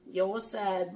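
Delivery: normal talking voice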